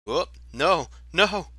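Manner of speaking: real alarm, climbing up; dismayed cry. Real, not acted. Fireball lands in the wrong place.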